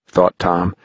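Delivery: plain reading voice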